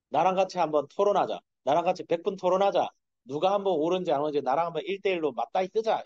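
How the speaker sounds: God picking a fight. Retold or imitated. Preacher voices God challenging Job, loud and cocky. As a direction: throw down the gauntlet, loud and cocky. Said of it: "이거예요. 하나님께서".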